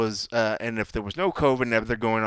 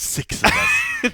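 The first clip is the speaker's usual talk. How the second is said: hushed mock echo; whispered echo; much slower than usual. Real, not acted. Cohost echoes the tiny crowd, slow whisper. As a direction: whisper it slowly and high.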